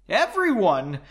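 clipped groaning cry; quick groan. Acted. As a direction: blurt it as a groan. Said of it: "Leonid gro".

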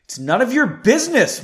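loud, high, defensive retort; shouting back. A narrator's reading. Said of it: "Margot shouted back".

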